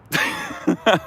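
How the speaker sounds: sudden high squeal of a laugh; high burst of laughter. Real, not acted. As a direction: crack up at your own bit. Laughs at his own rant.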